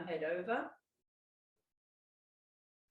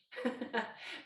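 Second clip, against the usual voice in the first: breathy laugh; 3 semitones higher, 4 dB quieter than usual. Real, not acted. Laughs at turning own head.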